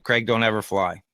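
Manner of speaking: ordinary talk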